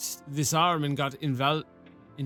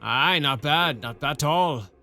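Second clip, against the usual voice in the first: put-on a rough rural-British-ish sergeant accent, loosely done; half-baked rustic soldier accent; gruff old soldier accent. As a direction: play the one-armed sergeant with a put-on country burr. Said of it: "I don't know what accent".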